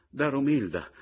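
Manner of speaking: high, loud urging; urgent call. A narrator's reading. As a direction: cry it out in alarm. Mother calls out in alarm.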